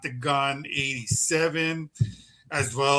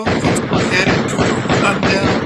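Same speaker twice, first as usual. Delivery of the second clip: announcer hype; 5 semitones higher, 9 dB louder than usual. Acted. Podcast host launching events rundown.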